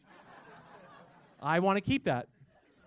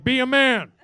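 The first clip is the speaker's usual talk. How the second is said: loud, higher, barked order; gruff macho bark; 5 semitones higher, 13 dB louder, slower than usual. Retold or imitated. Manly book ordering the reader to man up, barked. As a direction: bark it like a drill sergeant. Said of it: "Just open it up and be like".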